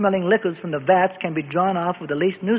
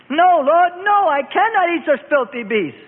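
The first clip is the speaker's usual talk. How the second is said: high, loud refusal; loud horrified refusal to God; objecting; 9 semitones higher, 4 dB louder than usual. A narrator's reading. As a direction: cry out in flat refusal. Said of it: "Peter objected to the insistent command".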